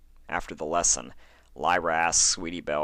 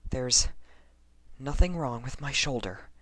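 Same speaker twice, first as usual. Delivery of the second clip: put-on higher, lighter young mare voice; Lyra's voice; 8 semitones higher than usual. A narrator's reading. Lyra reassuring herself, higher voice. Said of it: "she said eventually".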